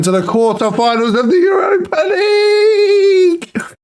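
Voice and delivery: crying out the triumph; tearful joyful shout. Real, not acted. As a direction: shout it while close to tears. Team through, emotional celebration.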